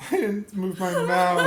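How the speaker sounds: talking through real laughter; words breaking up with laughs; laughing. Real, not acted. Laughing fit over cow mistake.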